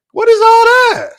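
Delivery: squeaky high, loud, incredulous yelp; mock shock. Host mocking the Gil clips in loud, high disbelief. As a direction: shriek it in fake disbelief.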